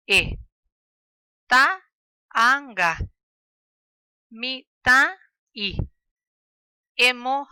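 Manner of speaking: each syllable called out loud with a stop between vowels; loud syllable drill. Shown as a demonstration. Teacher drilling the class aloud on puso syllables, loud and separated. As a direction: shout each piece to the class, then pause. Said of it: "Escucha a la profesora, luego lee".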